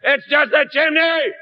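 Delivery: high, slow, put-out neighbor woken at night. Acted. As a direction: drag it out like a groggy, annoyed neighbor. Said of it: "And [name] says".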